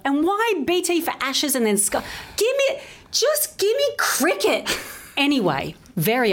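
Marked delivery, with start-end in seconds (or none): 0.0-4.7 high-pitched, pleading exasperation; rising to a squeal of comic frustration; exasperated rant. Real, not acted. Fan railing at cricket's TV channels, high exasperated plea.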